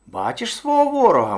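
very high, goading little hare; high-pitched wily hare. A narrator's reading. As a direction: point out the enemy in a high voice. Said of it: "мовив заєць".

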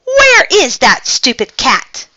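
loud, high-pitched annoyance; irritated grumble. A narrator's reading. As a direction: grumble crossly at full voice. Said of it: "muttered the driver".

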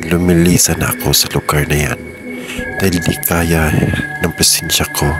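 ordinary talk

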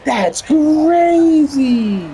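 high, loud and very slow; stretched disbelief. Real, not acted. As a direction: draw it out in disbelief. Reacting to a call.

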